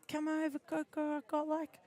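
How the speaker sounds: imitating a friend's Kiwi accent, half-hearted. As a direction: quote your Kiwi friend in a breezy New Zealand accent. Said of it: "Paula has quite a thick accent".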